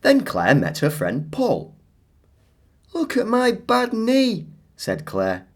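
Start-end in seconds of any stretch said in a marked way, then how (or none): 2.9-4.5 put-on high childlike girl voice; Claire's voice. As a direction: voice little Claire showing off her knee.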